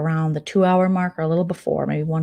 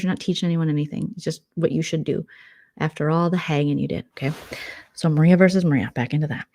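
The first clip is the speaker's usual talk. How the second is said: ordinary delivery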